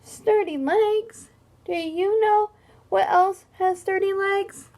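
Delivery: offended hippo. Acted. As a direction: sulk like a hurt hippo. Hippo's hurt protest voiced.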